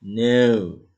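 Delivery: a bit camp. Shown as a demonstration. Teacher says no with a playful camp flair. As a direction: make no a little mincing. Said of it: "jadi agak kemayu".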